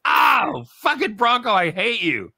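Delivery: loud, high cry of mock hatred; exasperated outburst. Real, not acted. Dad curses his friend over the missed name, loud and anguished. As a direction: wail it in loud, high regret.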